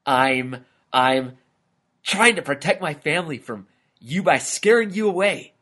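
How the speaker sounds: halting start, then high and rushing out; stammer, then blurt out your brave explanation; shocked lizard finding his nerve; nervous courage. A narrator's reading. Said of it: "built up the courage to say something back".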